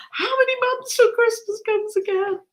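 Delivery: put-on pine for Christmas like a child; impatient whine. To viewers about waiting all year, high impatient mock whine. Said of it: "I'm like".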